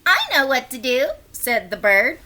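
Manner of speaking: plain reading voice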